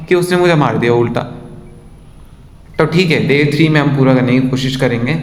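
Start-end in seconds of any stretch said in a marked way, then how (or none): none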